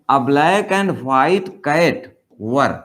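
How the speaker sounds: each word spelled out very slowly; slow dictation. Shown as a demonstration. Teacher reads the example to the class, very slow. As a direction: read it out slowly like dictation for students.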